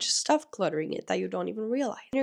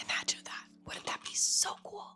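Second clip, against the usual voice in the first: whispering; 8 dB quieter than usual. Real, not acted. Dreamy whispered aside.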